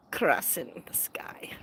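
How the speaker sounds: slow breathy whisper. Real, not acted. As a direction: whisper it slowly. Soft slow aside.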